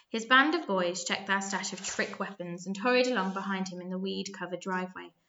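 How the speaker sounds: plain reading voice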